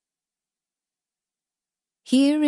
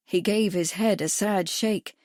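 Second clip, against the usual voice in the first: ordinary delivery, nothing marked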